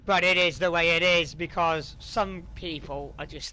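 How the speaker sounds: loud exasperated rant; heated and fed up; angry. Real, not acted. Heated apology about trolls.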